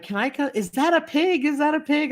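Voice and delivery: put-on high, excited kid voice; mock excited child asking; kids shrieking. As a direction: squeal it like a thrilled kid. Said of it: "the kids start screaming, and they're like".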